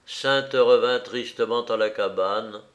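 ordinary delivery, nothing marked

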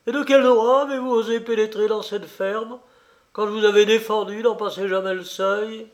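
bitter accusing question, pitched high; scathing bitterness. A narrator's reading. Old farmer to the count who entered his farm, bitterly indignant. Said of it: "dit-il avec une amertume sanglante".

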